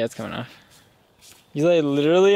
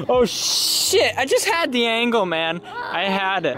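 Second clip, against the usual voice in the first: frustrated; 7 semitones higher, slower than usual. Real, not acted. Missed the photo.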